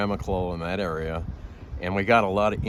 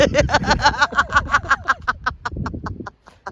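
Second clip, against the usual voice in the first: a long, much higher, loud cackle; long high laugh; 5 dB louder than usual. Real, not acted. Chief laughing after the punchline, long and high. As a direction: laugh it out hard. Storyteller laughs at own story.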